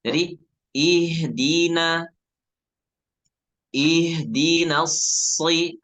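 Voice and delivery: ihdina: nun said thin, slow and drawn out; thin nun demo. Shown as a demonstration. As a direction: stretch ihdina slowly with a light thin n. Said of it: "nah itu tipis".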